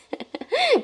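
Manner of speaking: short high-pitched giggle; high laugh. Real, not acted. Laughing at buying only a sachet, high giggle. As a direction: burst into a squeaky laugh. Amused at own poverty story.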